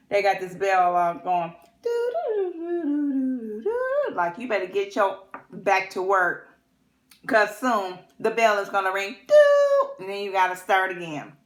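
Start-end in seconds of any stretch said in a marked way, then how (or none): 1.8-4.2 high sung bell chime doo doo; bell imitation. 9.3-9.9 loud high bell chime; ding the bell, high and loud; bell imitation.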